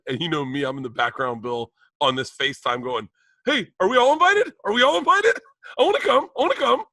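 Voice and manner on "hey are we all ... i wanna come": put-on very high, childlike eager pleading; mock eager begging. Bert in the FaceTime background wanting an invite, squeaky pleading. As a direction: pipe up like someone desperate to be included.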